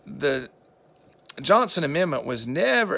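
ordinary talk, nothing marked

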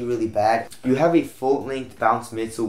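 normal talking voice

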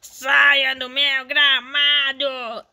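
put-on slow, drawn-out grumpy old man voice. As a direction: growl it slowly like a cranky old neighbor. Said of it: "Aquele velho que fala".

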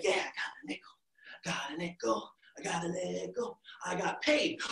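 soft, breathy secret glee; excited whisper. Acted. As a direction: whisper it to yourself happily. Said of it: "he's like".

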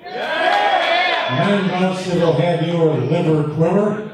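high, rising hype for the crowd; boxing ring announcer. Real, not acted. Hyping crowd before the bouts.

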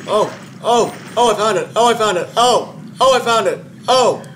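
loud, high, frantic cries of relief; excited shouting. Real, not acted. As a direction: yell it out, found the way. Finding the exit while chased.

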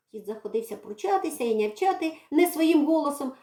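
ordinary delivery

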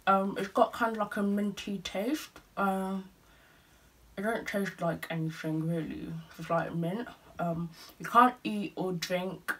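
talking with teeth strips on; speaking around a dental strip. Real, not acted. Seen 0: Dark strip visible covering teeth while talking; applied just before.

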